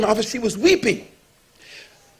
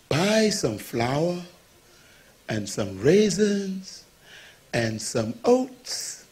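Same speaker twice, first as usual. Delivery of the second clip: slow deliberate list; 6 semitones lower, 8 dB quieter, much slower than usual. Retold or imitated. Pastor listing groceries slowly.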